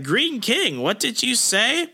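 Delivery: high-pitched imitation of a questioning underling; startled questioning voice. Meek questioning the Green King, high imitated voice.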